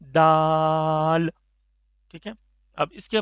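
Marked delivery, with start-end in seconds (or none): 0.0-1.3 दाल held very long and clear; very slow demo दाल; clear daal. Shown as a demonstration. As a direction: stretch out दाल slowly. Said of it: "बहुत easy".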